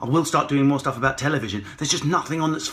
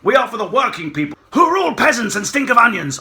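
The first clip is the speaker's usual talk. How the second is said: higher, loud snobby shout; posh Tory rant; 8 semitones higher, 5 dB louder than usual. Pretend. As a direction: shout like a sneering toff. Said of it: "my mask fell off".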